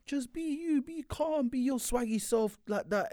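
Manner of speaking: high pleading. Real, not acted. Pleading with player.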